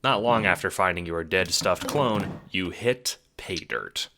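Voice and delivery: usual speaking voice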